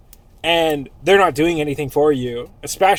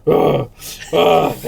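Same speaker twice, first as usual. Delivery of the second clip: loud heaving ape grunts; mock brutish grunting; 5 dB louder than usual. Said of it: "You have this ape over you".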